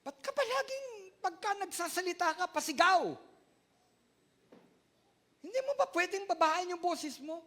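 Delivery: a hint of a nagging mom; mother scolding. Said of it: "Sabi ng mami ko sa akin".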